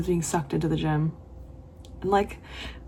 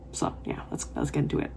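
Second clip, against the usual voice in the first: whispered; 8 dB quieter than usual. Real, not acted. Whispered lead-in to reading.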